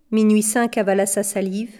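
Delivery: plain reading voice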